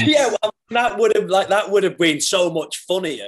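high, loud, laughing agreement; real amusement, voice jumping up; amused. Real, not acted. Actor reacting to host's beans joke, laughing.

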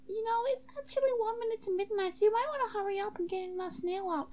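high, quick character voice; high-pitched podcast sidekick, hurried; high-voiced sidekick. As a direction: rattle it out in a high put-on voice.

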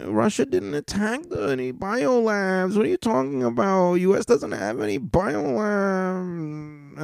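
very high, whiny-caricature denial; mocking denier. Mimicking those who deny the US biolabs, high mocking voice. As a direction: put on a high mock voice with stretched words.

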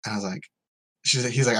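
ordinary delivery

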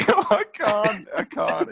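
ordinary delivery

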